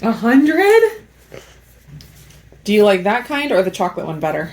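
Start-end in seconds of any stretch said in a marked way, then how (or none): 0.0-1.0 high, loud amazed question; delighted disbelief at the answer; surprised. Real, not acted. Child rates it a hundred.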